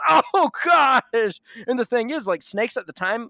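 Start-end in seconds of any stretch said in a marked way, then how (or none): 0.1-1.4 high, loud, hammed-up "oh gosh"; drawn-out cartoonish dismay; mock dismay. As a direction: play a comic villain caught out. Caught Satan reacts in dismay.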